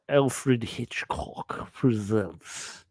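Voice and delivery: very slow, ponderous film-director voice; droll, drawn-out jowly delivery; Alfred Hitchcock. Acted. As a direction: draw every word out, heavy and deliberate. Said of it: "had a pretty good Alfred Hitchcock".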